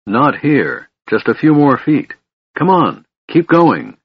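high-pitched urgent coaxing. A narrator's reading. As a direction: urge them on, pitch climbing. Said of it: "Silvara said urgently".

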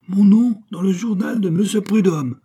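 high, loud indignant outburst; outraged cry. Acted. Abbé outraged at the newspaper threat, loud cry. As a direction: shout it in disbelief. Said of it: "s'écria l'abbé".

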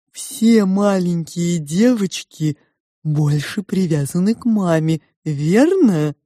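slow, wheedling sow voice; insinuating pig. A narrator's reading. The pig probes the girl's love for mother, insinuating. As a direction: ask slyly, stretching the words.

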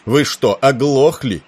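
loud, high angry demand; roar. A narrator's reading. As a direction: bellow it at someone not listening. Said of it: "взревел Тернер".

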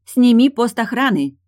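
"Сними пост охраны" shouted high and loud; shouting into the phone. A narrator's reading. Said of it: "закричала в трубку Тильда".

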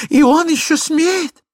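very high, loud, drawn-out wail; tearful outcry. A narrator's reading. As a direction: wail in outrage as the wife. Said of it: "кричала супруга, заливаясь слезами". (пронзительный возмущённый крик супруги)

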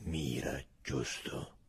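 sergeant's imagined command to the sentry; hoarse order. Acted. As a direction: rasp out the order, almost voiceless. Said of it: "la voce rauca di Tronk".

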